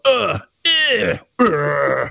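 string of mock dinosaur grunts; imitated raptor calls. Man dropped in Marrakesh trying raptor talk, guttural grunts. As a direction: grunt and growl as if speaking raptor. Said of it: "then I just go".

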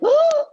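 sharp, high-pitched shocked gasp; big gasp. Retold or imitated. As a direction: mimic a huge startled intake of breath. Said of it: "That was a big gasp".

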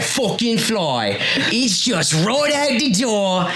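indignant, rising into high drawn-out Cockney; angry accent slip. Acted. Host spotting a fly, angry Cockney. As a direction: let the old Cockney burst out, high and stretched. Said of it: "but when he gets angry".

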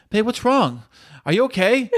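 retold worried check-in, half joking; concerned. Husband re-enacting last night's check on his wife, concerned.

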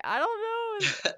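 high-pitched baffled reply; retold bewilderment. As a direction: act out being at a loss, voice jumping up. Retells own bewildered reply.

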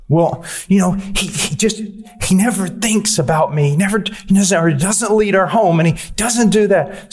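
a light mock of a wife complaining. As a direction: imitate a wife listing grievances. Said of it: "Or the husband says".

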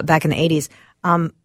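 ordinary talk

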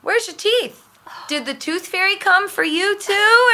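put-on high-pitched, innocently nosy child; little niece's voice. Five-year-old niece questions brother's old friend, high child voice. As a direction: pipe up like a curious small girl. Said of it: "Gianna was like".

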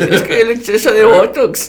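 high, far put-on diva voice; mock botox diva. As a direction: play a vain botoxed diva talking.

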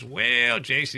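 high, put-on Virginia accent; Keith Allen Harward's Virginia accent. Exoneree answering the podcast host, imitated Virginia accent. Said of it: "I can do his accent pretty well".

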